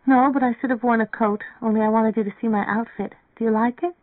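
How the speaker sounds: girl seeking approval, voiced high; girl character. A narrator's reading. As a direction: lift the pitch to play the girl. Voices Mary Ann.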